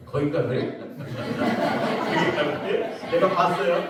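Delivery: ordinary delivery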